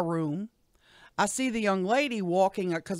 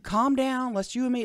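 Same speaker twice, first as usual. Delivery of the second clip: mild mock holler, pitched up; retold holler; 6 semitones higher than usual. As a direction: re-enact calling out to calm her. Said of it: "but I hollered at her".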